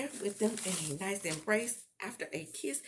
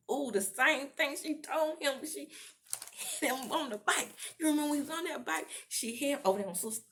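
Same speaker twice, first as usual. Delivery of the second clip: high, loud, rushing reminder; excited recollection; 7 semitones higher, 5 dB louder than usual. Real, not acted. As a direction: burst out with it, pitched up. Excitedly recalling an earlier scene.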